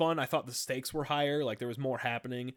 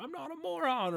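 much higher, squeaky robot whine; Wheatley; 14 semitones higher than usual. Retold or imitated. Friend imitating Wheatley freaking out, high squeaky whine. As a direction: whine it like a panicky idiot robot. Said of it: "He's like".

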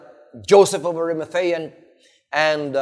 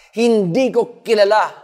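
loud, higher, emphatic denial; swearing denial; 5 semitones higher, 4 dB louder than usual. Acted. Peter cursing himself as he denies Jesus, emphatic. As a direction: deny it forcefully, voice raised. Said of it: "he even cursed at himself".

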